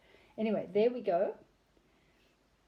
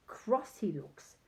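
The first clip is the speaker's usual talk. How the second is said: plain reading voice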